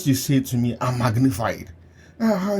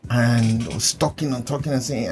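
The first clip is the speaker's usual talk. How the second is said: ordinary talk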